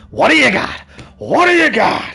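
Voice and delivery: high, loud barked question; Pacino shouting. Retold or imitated. Imitating Pacino shouting the question, high and loud. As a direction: bark it like Pacino.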